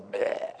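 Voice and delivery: mock retching "bleh"; vomiting noise. Fish barfing Jonah up on shore, imitated retch. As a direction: spit out a drawn-out barfing noise.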